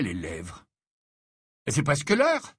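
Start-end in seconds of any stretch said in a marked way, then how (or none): 1.7-2.5 lightly announced, pitched up; breezy, casual announcement, higher voice. A narrator's reading. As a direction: say it airily. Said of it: "d'un ton léger".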